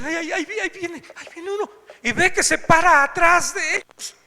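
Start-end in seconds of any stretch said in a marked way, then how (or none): 0.1-1.7 frightened. Retold or imitated. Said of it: "empieza a temblar la mujer".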